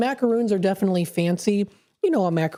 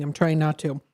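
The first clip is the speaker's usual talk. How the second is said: usual speaking voice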